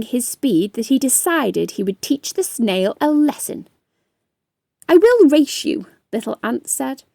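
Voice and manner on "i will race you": higher, louder ant throwing down a challenge; the ant, stung, taking the bet; challenging ant. Acted. Little Ant challenges snail.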